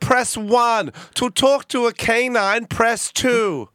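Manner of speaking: high, emphatic automated menu voice; mock recorded-service operator, high and insistent; automated phone service. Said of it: "this is an automated service".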